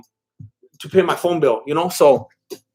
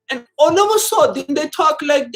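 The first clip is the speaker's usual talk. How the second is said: put-on high-pitched, botched British accent; 11 semitones higher than usual. Streamer mocking actors who change accents, bad British accent. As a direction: attempt British and miss badly. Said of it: "that's my British accent".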